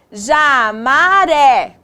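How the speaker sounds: "Jamaré" very slow, each syllable stretched; sounding out. Shown as a demonstration. Teacher testing made-up word.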